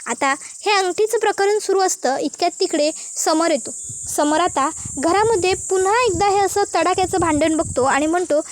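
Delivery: usual speaking voice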